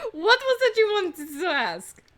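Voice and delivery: put-on high, loud, drawn-out French accent. Housemaid asking officers their question, French accent. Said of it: "doing a French accent".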